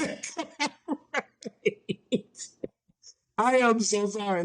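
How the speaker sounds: laughing through the word; breaking into high giggles; giggling it out. Real, not acted. Cracking up mid-sentence.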